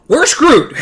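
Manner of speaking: loud high panicked cry; high loud wail. Retold or imitated. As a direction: wail it in despair. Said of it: "She said".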